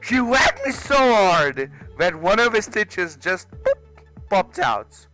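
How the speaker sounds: usual speaking voice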